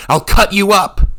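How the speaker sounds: high, loud threat; furious threat. A narrator's reading. Revna threatens the monk with her knife, shouting.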